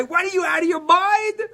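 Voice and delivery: high, loud mock scream; wife screaming. Wife screaming at husband for selling donkey, loud high shriek. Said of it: "screaming at the guy".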